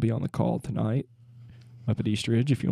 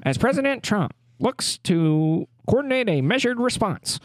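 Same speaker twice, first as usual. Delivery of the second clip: higher mid-Atlantic newsreel voice; 1940s newsreel announcer; 7 semitones higher than usual. Acted. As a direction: read the news like an old newsreel. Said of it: "nineteen forties radio announcer voice".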